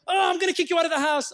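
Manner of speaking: exasperated parent. Acted. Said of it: "you know, like parents".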